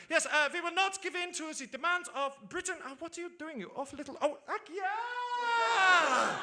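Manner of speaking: high-pitched, flustered mock German accent; German-accented panic climbing into a long high cry; Angela Merkel. Chancellor panicking as she is interrupted mid-speech, mock German accent. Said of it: "she'll be really panicking then".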